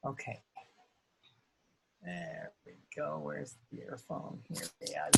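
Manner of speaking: far from the mic, very faint; muttering away from the microphone. Real, not acted. Fiddling with earphone off mic.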